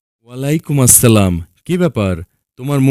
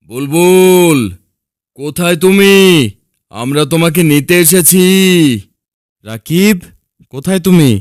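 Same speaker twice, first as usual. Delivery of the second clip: calling out; 5 semitones higher than usual. Acted. Father searching for lost Bulbul and Rakib, calling out. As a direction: call into the distance for someone lost.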